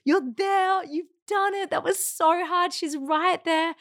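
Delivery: rally her on, you've done it; lifted, excited encouragement to the mother; cheering birth team. Retold or imitated. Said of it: "They were like".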